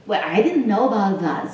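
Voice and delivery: ordinary talk, nothing marked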